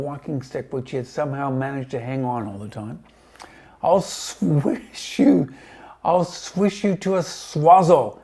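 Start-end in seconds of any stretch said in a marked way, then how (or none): 3.8-8.2 high, loud, slow furious threat; enraged hag shouting down; raging shout. A narrator's reading. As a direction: hammer each threat out in rage. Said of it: "she shouted".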